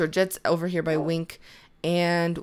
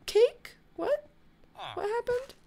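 baffled; 14 semitones higher, 6 dB quieter than usual. Real, not acted. Cake appears after death.

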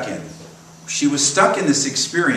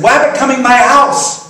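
put-on high, loud little-child voice; toddler voice; 10 semitones higher, 7 dB louder than usual. Pastor voicing the little girl asking when the rabbit comes, high toddler voice. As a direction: chirp it like an excited toddler.

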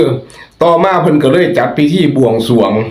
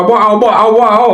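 firm refusal; 7 semitones higher than usual. Retold or imitated. Host retelling how he refused a scam caller, firm refusal.

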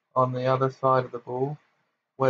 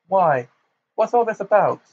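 bewildered; 7 semitones higher, 6 dB louder than usual. Acted. Fudge bewildered.

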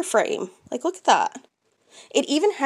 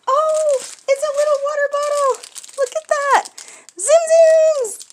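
high, loud squeal of delight; excited squeal; 14 semitones higher, 5 dB louder than usual. Real, not acted. Discovering the Zim Zims water bottle, squealing loud. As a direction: squeal over the new bottle.

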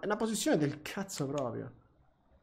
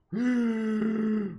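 sharp startled gasp; 7 semitones higher, 4 dB louder than usual. Real, not acted. Plane suddenly drops in the sim.

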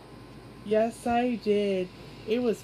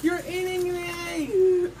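panicked cry; 5 semitones higher, 4 dB louder, slower than usual. Acted. Toy being eaten cries out.

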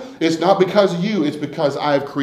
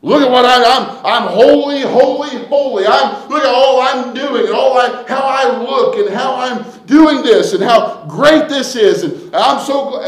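mock holy boaster; 8 semitones higher, 7 dB louder than usual. Mocking a self-righteous boaster.

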